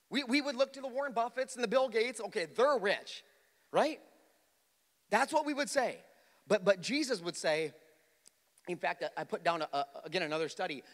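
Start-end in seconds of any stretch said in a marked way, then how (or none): 0.1-9.2 high, loud pulpit preaching; full-force preaching. Acted. Pastor preaching hard to his congregation, loud and high. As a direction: preach it out to the room.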